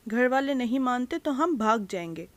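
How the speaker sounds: usual speaking voice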